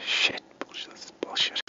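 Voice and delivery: faint, breathless whispered curse; dying whisper. Acted. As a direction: fade out as life drains away. Dying hunter fades out.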